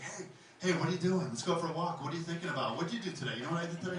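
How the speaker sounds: needy dog. Acted. Dog greeting owner, voiced needily.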